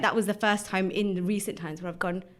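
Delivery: ordinary talk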